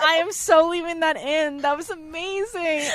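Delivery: high, giddy delight; real gleeful excitement. Real, not acted. Host refusing to cut the blunder, gleefully excited. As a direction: crow it with glee.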